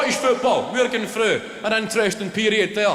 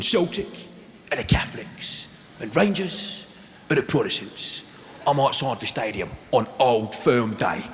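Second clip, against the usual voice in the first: Danny Dyer; 6 semitones lower than usual. Retold or imitated. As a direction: present it like a gritty TV host. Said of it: "He's going, he's going".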